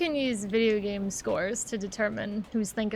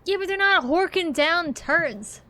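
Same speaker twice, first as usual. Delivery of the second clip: louder, slower and insistent; emphatic; 7 semitones higher, 5 dB louder, slower than usual. Real, not acted. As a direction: lean hard into each word. Arguing about cats eating poop.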